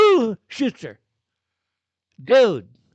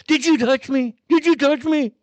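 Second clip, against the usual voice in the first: high, louder mock officer whining; 8 semitones higher, 5 dB louder than usual. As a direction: parody the agent's "Did you touch me?". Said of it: "then the".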